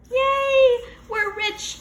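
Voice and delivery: high, loud joyful shout. A narrator's reading. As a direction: cheer it out. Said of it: "I shouted".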